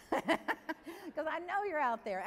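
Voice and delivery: high, drawn-out amused tease; laughing. Real, not acted. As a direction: laugh your way into the line.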